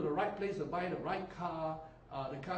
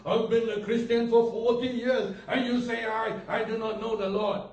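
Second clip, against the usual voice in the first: mock-indignant outburst, louder; indignant churchgoer; 4 semitones higher, 10 dB louder than usual. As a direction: play the insulted veteran believer. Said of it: "don't hide under some religiosity".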